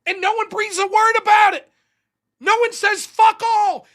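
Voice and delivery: high, loud, indignant rant; exasperated shout. Real, not acted. Ranting about the missed attack.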